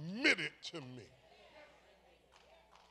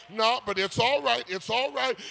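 ordinary delivery

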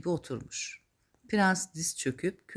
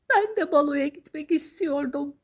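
high, louder tearful Cinderella; high-voiced mock sobbing plaint; acted sobbing; 11 semitones higher, 5 dB louder than usual. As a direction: wail it like a storybook girl. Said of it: "demiş hıçkırarak kül kedisi".

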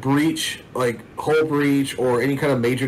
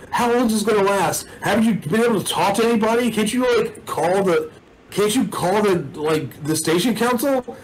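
higher-pitched, insistent pestering at only slightly raised volume; yelling crowd questions; 7 semitones higher than usual. Acted. Angry crowd questioning the mayor, mock yelling. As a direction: imitate upset townsfolk firing questions upward. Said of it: "People are yelling up questions".

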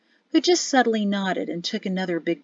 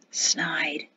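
silent sneering whisper; just mouth the word, no voice; 4 semitones lower, 7 dB quieter than usual. Acted. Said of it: "he mouthed and sneered".

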